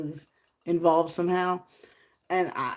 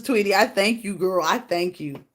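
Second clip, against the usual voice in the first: ordinary talk, nothing marked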